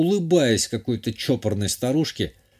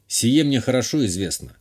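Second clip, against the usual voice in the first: ordinary delivery, nothing marked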